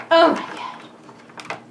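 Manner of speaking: breathless whisper of awe; awed whisper. Real, not acted. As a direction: whisper it, stunned.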